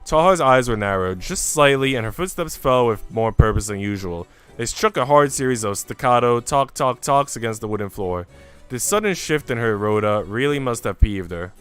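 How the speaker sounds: ordinary delivery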